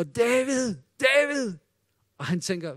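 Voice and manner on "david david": higher-pitched call to someone far off; imitated calling out. Preacher imitates someone summoning the shepherd boy, calling out. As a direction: call the name out across a field. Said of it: "der er en, der råber".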